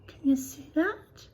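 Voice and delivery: plain reading voice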